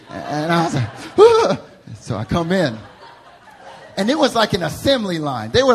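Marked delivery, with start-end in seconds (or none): 1.2-1.6 high loud startled yelp; scared yelp. Retold or imitated. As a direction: squeal like a caught kid. Child's frightened cry.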